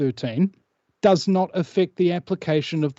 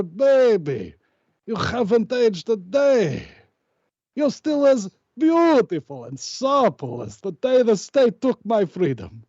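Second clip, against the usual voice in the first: high, slow, melodramatic sweet talk; hammed-up tender to wife; 7 semitones higher than usual. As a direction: swoon over her like a soap-opera lover. Greets wife after jail.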